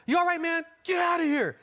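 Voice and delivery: hammed-up caring bandmate; high-pitched mock check-in on the tour bus; mock concerned sober friend.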